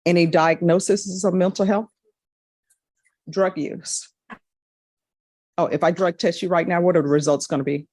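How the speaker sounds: usual speaking voice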